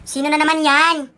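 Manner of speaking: loud call to the door. Acted. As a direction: shout out to an unexpected visitor. Calling out to someone at door.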